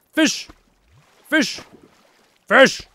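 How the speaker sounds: high, excited calls; calling fish. Real, not acted. Streamer shouts at fish while playing, excited. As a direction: call out at the fish in game.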